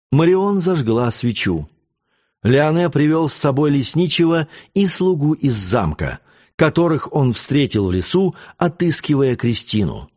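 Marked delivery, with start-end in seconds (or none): none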